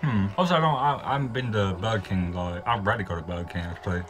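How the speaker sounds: chewing while talking; talking between bites of chip; mouth full of chip. Real, not acted. Seen 0: bit chip at -3; food in mouth, chip held throughout.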